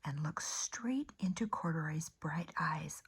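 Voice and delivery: gentle storybook narration, hushed at phrase ends; soft storytime voice; reading to children. A narrator's reading. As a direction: read it like a bedtime picture book. Reads picture book to children.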